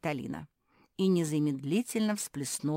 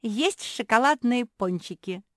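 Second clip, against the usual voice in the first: high, loud, drawn-out cheer; jubilant; 8 semitones higher, 10 dB louder than usual. A narrator's reading. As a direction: crow it slowly with glee. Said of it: "заликовала Мафи".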